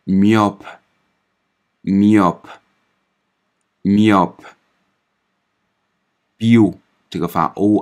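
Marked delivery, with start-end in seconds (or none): 0.0-4.5 myope stretched very slowly, slightly louder; slow French demo. Shown as a demonstration. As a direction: pronounce myope slowly and clearly. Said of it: "发一的音". (极慢拉长读myope)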